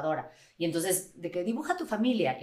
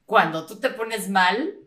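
slow and louder, breaking into laughter; imitating her child; 3 semitones higher, 6 dB louder, slower than usual. Daughter telling her mom she scares them, slow laughing quote. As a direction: quote the kid, laughing through it.

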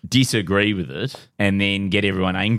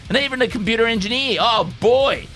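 higher, pumped-up talk radio ranter; conservative shock jock; 8 semitones higher than usual. Acted. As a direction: rant like an outraged radio host. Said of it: "in the voice of a conservative shock jock".

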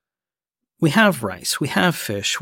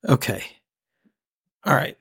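ordinary talk, nothing marked

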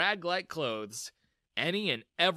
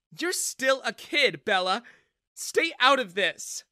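high, loud, slow warning; stern rebuke; 9 semitones higher, 8 dB louder, slower than usual. A narrator's reading. Mother telling daughter to keep out, stern. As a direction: put her firmly in her place. Mother frowns, argues back.

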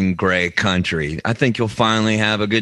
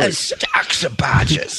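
higher, slow caricature British; mock British accent; 6 semitones higher than usual. Retelling the pub guy's line, mock British accent. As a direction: stretch it in broad British.

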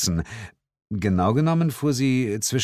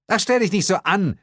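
dismissive; 13 semitones higher, 4 dB louder than usual. A narrator's reading. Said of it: "sagte Sheila".

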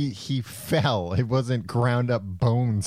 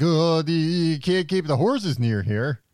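manager voice; 5 semitones higher, 3 dB louder, slower than usual. Retold or imitated. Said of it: "this manager at McDonald's was like".

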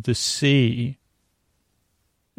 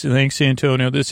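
ordinary delivery

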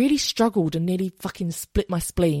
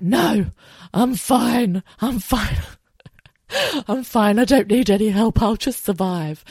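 louder, insistent mock denial with a laugh; hammed-up martyr insisting she's fine; stubborn "I'm fine"; 3 semitones higher, 4 dB louder, slower than usual. Retelling refusing mum's help.

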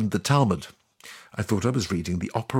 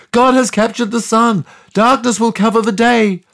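much higher, louder apocalyptic shout; shouting prophet; 11 semitones higher, 7 dB louder than usual. Retold or imitated. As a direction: proclaim doom to the sky. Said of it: "And he shouts".

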